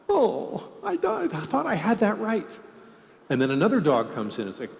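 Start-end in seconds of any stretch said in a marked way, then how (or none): 0.1-2.6 put-on very high, crestfallen kid voice; disappointed small child. Child to parent after being told it's a dog, disappointed child voice. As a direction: whine it like a corrected toddler. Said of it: "the child goes".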